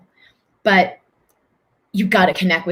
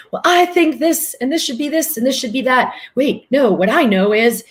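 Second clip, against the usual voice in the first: high, fussy inner-voice chatter, much higher; mock busy mind; 6 semitones higher than usual. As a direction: ham up a racing, arguing mind. Mocking the mind's inner chatter.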